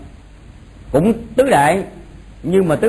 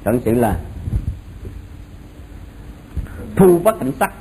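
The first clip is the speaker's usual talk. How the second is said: dictating; 3 semitones lower, 5 dB quieter than usual. Shown as a demonstration. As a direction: read it out for students to copy. Teacher dictating notes to class.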